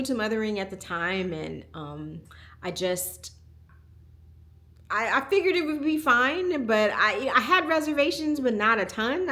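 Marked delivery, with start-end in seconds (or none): none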